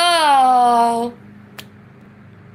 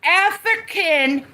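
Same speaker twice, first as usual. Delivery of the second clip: outraged rant; 7 semitones higher than usual. Real, not acted. Outraged rant about crocodiles.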